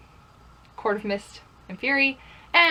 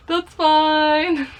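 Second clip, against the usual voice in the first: higher, louder, sing-song that's fine; playful shrug; 5 semitones higher, 6 dB louder than usual. Acted. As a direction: brush it off with cheeky defiance. Playful defiance about liking hated things.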